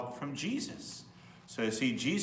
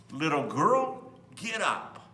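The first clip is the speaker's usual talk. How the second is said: put-on louder, slow call to the sleeping girl; Jesus calling the girl back to life; gentle command; 2 semitones higher, 5 dB louder, slower than usual. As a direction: wake her kindly but firmly.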